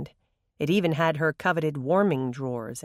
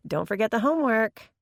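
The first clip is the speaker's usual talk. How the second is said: sweetly; 6 semitones higher than usual. A narrator's reading. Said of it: "Megan pitched in sweetly".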